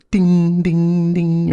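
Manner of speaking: high sing-song ding, ding, ding; imitated ding. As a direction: chime it like the detector does. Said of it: "it's more of a beep".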